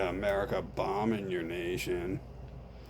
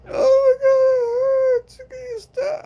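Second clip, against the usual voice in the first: much higher, loud crying plea, slowed; hammed-up sobbing through the pain; mock sobbing wail; 11 dB louder than usual. Victim begging friend to stop the woman, fake sobbing.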